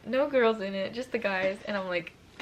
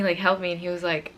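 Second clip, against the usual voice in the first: ordinary delivery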